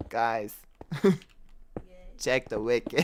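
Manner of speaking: lightly mock rapper voice, laughing; slight rapper impression broken by giggles. Streamer joking about sounding like a rapper, laughing impression. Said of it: "I sound like [name]".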